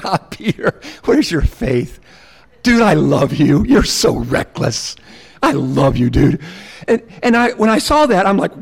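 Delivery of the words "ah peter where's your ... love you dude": affectionate, low fatherly chiding; tender, with a hint of a chuckle; fatherly, lighthearted. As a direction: gently tease someone you love. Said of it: "a fatherly tone in Jesus".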